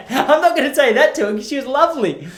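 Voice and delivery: high-pitched, laughing through the words. Real, not acted. Taking back a joke about a lovely old lady, laughing. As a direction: laugh as you take back the insult.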